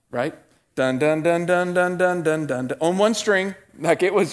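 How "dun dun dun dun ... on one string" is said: sung guitar riff. Shown as a demonstration. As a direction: mimic the guitar line with your voice. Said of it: "first song I learned ... Wipeout".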